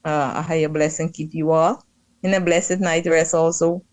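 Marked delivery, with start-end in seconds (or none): none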